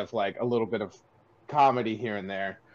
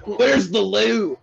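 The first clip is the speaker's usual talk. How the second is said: loud, high, drawn-out mock South African accent; badly faked South African accent, stretched out slowly; 8 semitones higher, 6 dB louder, much slower than usual. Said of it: "I can't do a South African accent".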